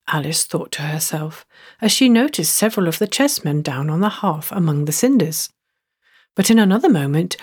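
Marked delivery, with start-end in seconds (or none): none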